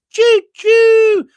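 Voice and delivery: high, loud hammed-up choo-choo; cartoon train whistle mocking a politician. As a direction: shriek it like a toy train. Mocking Clegg boarding gravy train.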